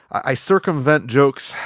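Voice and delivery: normal talking voice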